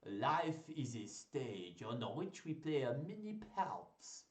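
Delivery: slow, hammed-up French accent. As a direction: recite grandly in a shaky attempt at French accent. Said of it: "shall I try a French accent?".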